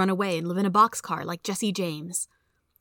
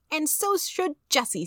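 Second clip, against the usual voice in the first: put-on very high, slow child's voice; little boy; 9 semitones higher, slower than usual. A narrator's reading. Little brother dragging his sister in, childish. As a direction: pipe up like a small kid, drawn out.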